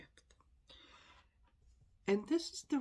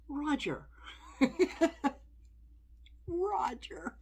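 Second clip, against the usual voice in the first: laughing disbelief; 7 semitones higher, 4 dB louder, slower than usual. Real, not acted. Laughing at quote.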